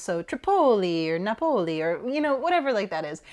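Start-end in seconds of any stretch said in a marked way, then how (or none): 0.2-1.8 Tripoli as truh-POH-lee, Napoli as nuh-POH-lee; second-syllable stress. Shown as a demonstration. Explaining the mistaken rule, Tripoli as truh-POH-lee, Napoli as nuh-POH-lee. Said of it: "the second syllable is what you emphasize".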